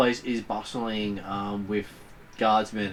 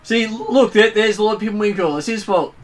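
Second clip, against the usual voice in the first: higher, louder accusing crowd voice; shouting crowd member; 9 semitones higher, 7 dB louder than usual. Acted.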